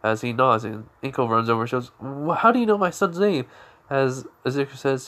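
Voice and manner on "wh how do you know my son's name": higher, startled protective question; alarmed mother. Acted. Inko confronting the stranger about her son, alarmed and higher. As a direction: demand it, caught off guard.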